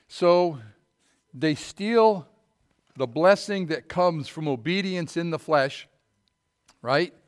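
forceful sermon delivery; preaching. Acted. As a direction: drive the point home to the pews. Preaching to congregation.

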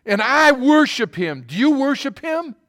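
excited worshipper. Retold or imitated. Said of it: "he got all excited about her".

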